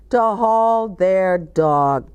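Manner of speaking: ordinary delivery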